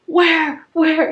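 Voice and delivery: high, loud, frantic double question; a half-awake man jolted into searching; startled husband. Retold or imitated. Husband woken up, frantically asks where the bat is. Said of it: "he goes".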